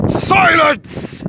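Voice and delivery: high, loud alien bellow; monstrous roar. A narrator's reading. The Gorg roaring down Hiro, monstrous shout. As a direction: roar it to shake the walls. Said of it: "the Gorg roared".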